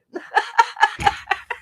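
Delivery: breathless high laugh; airy giggle. Real, not acted. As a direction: giggle without voice. Laughs at her own quote.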